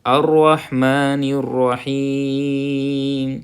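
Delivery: slow, drawn-out tajweed recitation; Quranic recitation. Shown as a demonstration. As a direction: chant each word slowly. (ٹھہر ٹھہر کر تلاوت)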